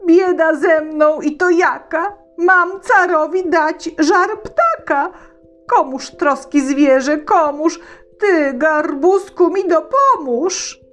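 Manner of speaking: put-on high, distressed boy's lament; Wania lamenting. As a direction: cry out for help in despair. (Wania żali się koniowi)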